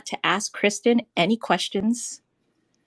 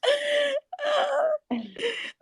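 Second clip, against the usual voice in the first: burst of laughter; 3 dB quieter than usual. Real, not acted.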